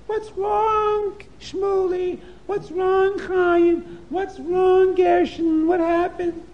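high motherly voice, concerned; worried mother. Acted. Mother greeting her scared children, worried and high. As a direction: ask each child what happened. Mother asks the frightened kids.

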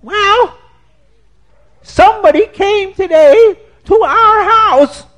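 high, loud wife breaking the news; wife's high voice. Acted. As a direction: tell your husband in a high, loud voice. Wife tells husband news.

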